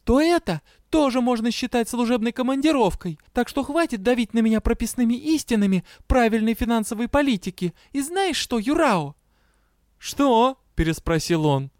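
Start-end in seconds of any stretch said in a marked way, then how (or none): 0.0-9.1 high, strained self-defense; restrained but cracking. A narrator's reading. Fed-up Дэя defends her stipend to Юрао, barely restrained. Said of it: "спокойно и сдержанно, но срывающимся голосом". 10.0-10.6 high, startled query; asking back. A narrator's reading. Challenged partner asks Дэя what she means, taken aback.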